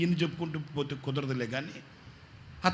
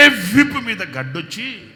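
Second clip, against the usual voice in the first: వీపు మీద slow, higher and louder; emphatic slow; 7 semitones higher, 9 dB louder, slower than usual. Acted. Pastor hammering the family curse, slow and louder. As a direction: drive వీపు మీద home.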